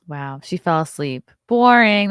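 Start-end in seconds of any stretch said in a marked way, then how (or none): 1.5-2.1 mock bored. Mocking the sleeping date.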